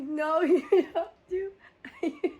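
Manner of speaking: laughing through a loud no; cracking up while shouting him down; laughing protest. Retold or imitated. Telling the coworker no U-turn, laughing loudly.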